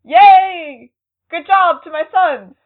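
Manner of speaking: high, loud proud cheer; excited cheer. Retold or imitated. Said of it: "part of me was like".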